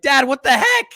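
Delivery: high, loud mock outrage at dad; confronting dad. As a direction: play the betrayed son, indignant. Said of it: "I was like".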